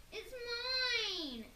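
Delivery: high, loud shriek. A narrator's reading. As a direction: shriek possessively. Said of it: "shrieked Peter".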